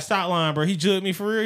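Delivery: high, loud "stop lying"; shocked disbelief. Retold or imitated. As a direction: protest the news in disbelief. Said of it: "I was like".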